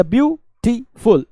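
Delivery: ब्यूटीफुल with a gap after each syllable. Shown as a demonstration. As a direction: separate ब्यूटीफुल into 'byoo, tee, ful'. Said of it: "ऐसे बोलेंगे".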